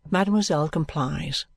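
plain reading voice

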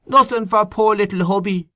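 husband calling after his wife, comic pleading voice; comical voice. A narrator's reading. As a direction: play the sulky little husband for laughs. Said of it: "He assumed such a comical face and voice".

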